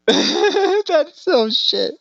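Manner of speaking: squeaky high laugh through the words, very slow. Real, not acted. Host cracks up at the guest's story, laughing high.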